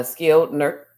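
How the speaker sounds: ordinary talk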